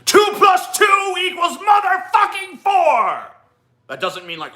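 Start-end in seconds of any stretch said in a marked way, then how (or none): 0.0-3.2 slow, high-pitched full shout; emotional. Shown as a demonstration. Host demonstrates being emotional yet logical, emotional shouting. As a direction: yell it far higher, drawing the words out. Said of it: "like, emotional".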